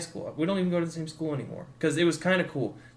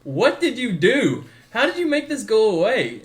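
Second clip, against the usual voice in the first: amazed disbelief; 7 semitones higher, 8 dB louder than usual. Retold or imitated. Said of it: "And I was like".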